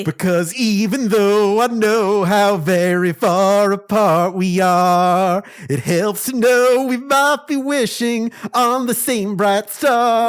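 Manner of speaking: high, slow, heartfelt line reading; tender reading. Acted. Reading a tender comic line aloud.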